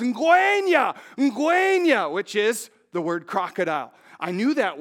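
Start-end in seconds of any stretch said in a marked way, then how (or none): 0.0-2.1 high, loud warning shout; mimicked urgent cry, pitched well up; imitated villager's yell. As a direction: yell it out like a villager sounding the alarm. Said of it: "he yells".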